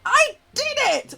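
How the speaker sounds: loud, high-pitched burst of delight; excited triumph. Real, not acted. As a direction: shout it out in high, amazed triumph. Celebrating success in the game.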